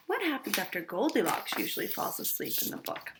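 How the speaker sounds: usual speaking voice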